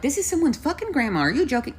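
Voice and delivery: retold appalled protest; incredulous outrage. Storyteller reacting to an old woman alone in rain, incredulous.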